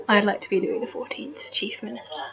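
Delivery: soft, near-whispered aside; hushed aside. Real, not acted. Wistful joking aside to listeners.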